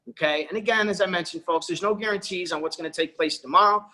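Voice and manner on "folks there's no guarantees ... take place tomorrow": ordinary talk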